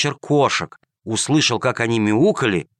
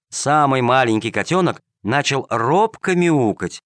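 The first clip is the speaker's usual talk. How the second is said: ordinary delivery, nothing marked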